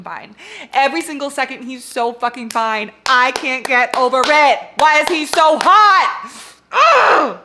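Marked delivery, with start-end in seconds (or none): 3.1-6.5 loud, rising fangirl wail; exasperated swooning at full volume; swooning outburst. Real, not acted. Swooning over the hero. 6.7-7.4 loud, high anguished cry; high fangirl wail; overwhelmed groan. Real, not acted. Overwhelmed by attraction to hero.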